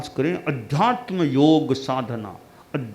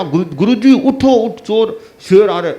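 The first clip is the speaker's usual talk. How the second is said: recalled urgent call; 5 semitones higher, 7 dB louder than usual. Retold or imitated. Disciple recalls shouting.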